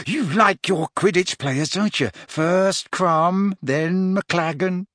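high, gossipy schoolgirl excitement; agog. A narrator's reading. Parvati agog at this piece of gossip, excited. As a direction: tease it out eagerly, slowing on the last name. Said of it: "looking positively agog at this piece of gossip".

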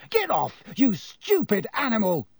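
angry shout pitched high, at the cat; roaring at the cat. A narrator's reading. Ron to the cat tearing his bag, angry roar. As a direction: yell it at the cat clawing the bag. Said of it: "Ron roared".

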